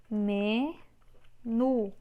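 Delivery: "Menu" split into ME, pause, NU; syllable by syllable. Shown as a demonstration. Teacher separating a word for students, syllable by syllable. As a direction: hold ME, pause, then say NU.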